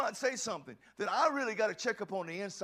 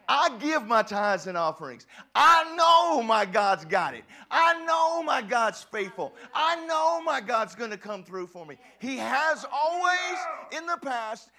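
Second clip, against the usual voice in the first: full-force preaching; 9 dB louder than usual. Real, not acted. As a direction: drive it home to the congregation.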